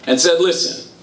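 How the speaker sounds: fervent sermon building to its point; impassioned preaching. Acted. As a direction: preach it to the whole hall. Preaching at full force.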